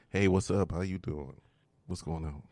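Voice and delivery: low, soft seductive murmur; sexy voice. Shown as a demonstration. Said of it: "just start talking to me".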